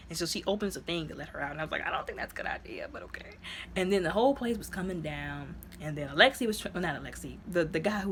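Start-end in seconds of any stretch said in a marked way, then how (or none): 1.8-3.3 fast, hushed whisper of doubt; doubtful whisper. Retold or imitated. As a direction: mutter the worry under your breath. Own reaction retold.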